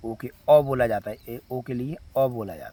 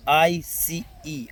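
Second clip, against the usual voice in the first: slow spelling; much slower than usual. Shown as a demonstration. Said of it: "spelling letter by letter".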